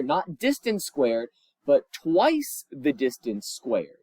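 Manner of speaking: slow, with weight on "not" and then on "twice"; contrastive stress. Shown as a demonstration. Teacher correcting students, slow with stress on "twice". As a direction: lean hard on "twice" to set it against "not". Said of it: "contrasting distance squared with twice it".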